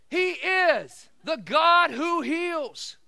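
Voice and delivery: loud, high preacher proclamation; full-force preaching. Real, not acted. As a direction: punch the key words at full preaching volume.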